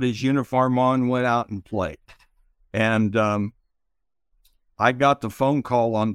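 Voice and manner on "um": ordinary talk, nothing marked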